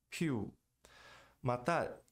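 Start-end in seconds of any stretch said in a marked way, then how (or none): 0.1-0.5 "pill" said like "pew"; dropped L. Shown as a demonstration. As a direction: end "pill" in W, no L. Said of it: "Se você não pronunciar o L".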